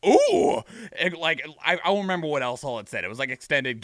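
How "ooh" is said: mock excited ooh. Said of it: "And I was like".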